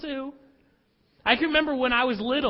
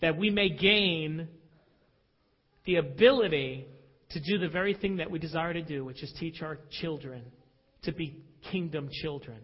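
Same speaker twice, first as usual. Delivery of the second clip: quiet closing prayer, words falling softer; 8 semitones lower, 6 dB quieter than usual. Acted. Pastor closing a prayer before the sermon, quiet and reverent. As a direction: pray it down softly to amen.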